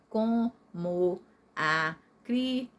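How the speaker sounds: COMO A CRIANÇA broken into co, mo, a, cri, an, ça; very slow separate beats on each syllable of COMO A CRIANÇA. Shown as a demonstration. Teacher reading the written division, COMO A CRIANÇA split up. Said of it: "Eu dividi tudo certinho".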